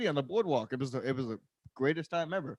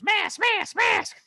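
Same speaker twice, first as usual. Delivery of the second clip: high, loud mock yell; shrieking old lady; 6 dB louder, much slower than usual. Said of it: "there's an old lady behind me".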